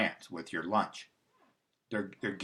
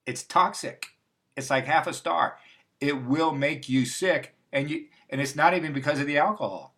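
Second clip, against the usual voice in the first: worked-up warning; 5 semitones higher, 5 dB louder than usual. Real, not acted. Heated warning about cheap wine.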